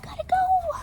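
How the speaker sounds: very high squeaky rush; frantic piggy; panicked pigs. Acted. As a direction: squeal it as they flee. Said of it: "they're all".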